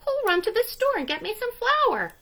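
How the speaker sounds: a bright little hen asking for help; hen's voice. A narrator's reading. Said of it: "she said".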